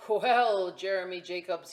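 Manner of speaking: a hint of a captain; lightly voiced pirate. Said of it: "he said".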